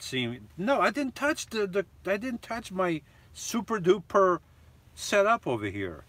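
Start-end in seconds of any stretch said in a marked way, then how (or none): 0.6-5.5 much higher, rushed insistence; a real flustered denial to chat; defensive protest. Real, not acted. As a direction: swear you touched nothing. Protesting he touched nothing.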